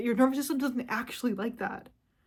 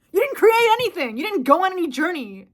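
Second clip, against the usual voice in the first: much higher and louder yell; loud outburst; 6 semitones higher, 5 dB louder than usual. Real, not acted. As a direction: shout them down. Ranting at AI users.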